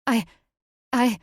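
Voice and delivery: halting, stammered start with pauses; faltering false starts; stammering. A narrator's reading. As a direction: stumble on the word and restart. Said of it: "she stammered".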